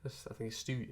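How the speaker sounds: normal talking voice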